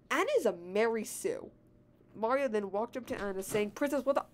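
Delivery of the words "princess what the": startled character line. A narrator's reading. Mario walks up to Anna, higher puzzled greeting. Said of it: "I just love how I said".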